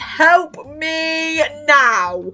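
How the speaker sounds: high, slow, stretched-out plea; overwhelmed fan wail; mock despair. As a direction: cry out for rescue, dragging it. Overwhelmed by the song scene.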